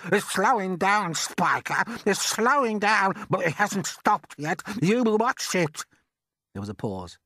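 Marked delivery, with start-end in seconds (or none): 0.1-5.8 a much higher, louder put-on aunt's voice, excited; Aunt Sponge. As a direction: play the greedy aunt gaping at the peach.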